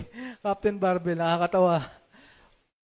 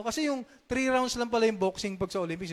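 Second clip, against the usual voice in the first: ordinary talk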